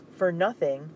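loud, indignant throwing back of "For nothing?"; angry echo. Acted. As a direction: fling "For nothing?" back at him loudly. Dupree furious at Mikhail.

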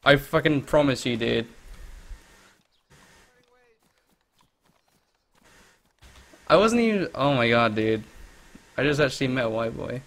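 loud, thrilled yelling; excited shouting. Real, not acted. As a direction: shout it out, starstruck. Excited shouting at meeting streamer.

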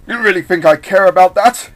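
loud, high villainous jeer; mocking. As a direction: sneer it out at full volume. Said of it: "Eggman mocked him".

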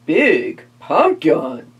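slow, deep vampire voice. Acted. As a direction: drawl it like a vampire. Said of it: "said the vampire".

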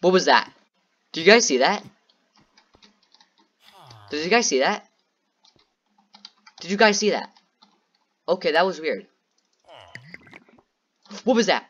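startled and scared. Real, not acted. Gamer spooked by a noise asking viewers what that was. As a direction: jump at every sound and ask the chat.

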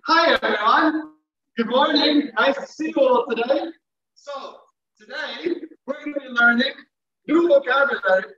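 upbeat, projected classroom hello; smiling greeting. Shown as a demonstration. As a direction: greet the class with a relaxed smile. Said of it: "relax, relax your face".

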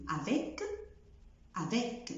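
avec ending in k; final c sounded. Shown as a demonstration. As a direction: say the k at the end. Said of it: "مش بقول". (avec بـ k)

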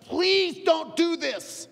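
father urgently begging his son; pleading father. Retold or imitated. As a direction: beg him not to miss it. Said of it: "He's like".

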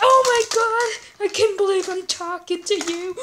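high, loud starstruck squeal; thrilled. Acted. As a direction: gush like a thrilled fan. Fan thrilled caller.

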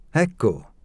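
triumphant ta-da. A narrator's reading. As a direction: announce the finished hairdo with pride. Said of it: "esclamò trionfante".

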